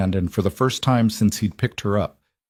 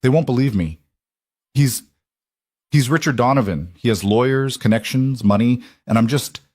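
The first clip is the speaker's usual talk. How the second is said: voice breaking; 3 semitones higher than usual. A narrator's reading. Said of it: "her voice breaking".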